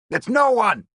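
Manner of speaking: sharp loud snap; snapping. A narrator's reading. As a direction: snap it shut fast. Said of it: "Lavelle snapped".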